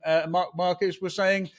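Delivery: ordinary talk, nothing marked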